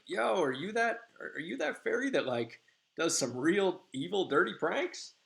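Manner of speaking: high, eager, teasing question; excited fairy. Retold or imitated. A fairy eagerly quizzes Puck about his evil pranks, high and thrilled. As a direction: ask it wide-eyed and thrilled.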